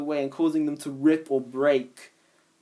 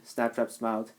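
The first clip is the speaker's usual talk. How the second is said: usual speaking voice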